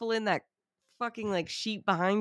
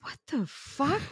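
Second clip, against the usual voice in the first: slow, much higher stunned disbelief; 8 semitones higher, slower than usual. Retold or imitated. Dinner guest quoting the shocked crowd, slow stunned disbelief. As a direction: let it hang, floored.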